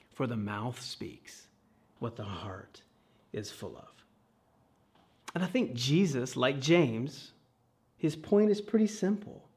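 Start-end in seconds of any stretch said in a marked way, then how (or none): 0.1-4.0 much softer, slow echo of the verse; quiet slow repeat. Real, not acted. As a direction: let the repeat sink in softly and slowly. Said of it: "Here's the kicker".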